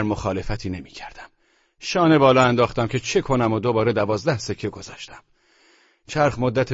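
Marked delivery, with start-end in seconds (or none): none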